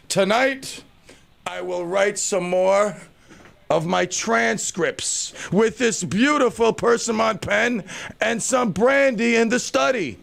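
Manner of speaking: slow, high, hammed-up grand writer; slow mock-refined gentleman planning his evening; smart important gentleman. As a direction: savor each word like a pompous author. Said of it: "I look like I'm smart and important".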